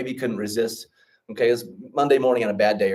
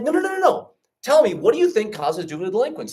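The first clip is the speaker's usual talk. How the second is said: much higher, pressing the question; insistent repeat; 10 semitones higher than usual. Retold or imitated. Friend insists the student answer, pressing higher. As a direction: push him to answer it. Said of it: "He's like".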